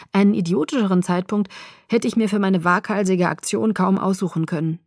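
ordinary delivery

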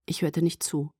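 ordinary delivery

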